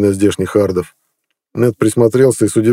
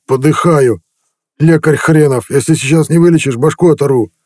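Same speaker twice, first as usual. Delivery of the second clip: pained angry threat; 6 semitones higher than usual. A narrator's reading. Sick chieftain threatens his useless healer, furious and hurting. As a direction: threaten him while doubled over.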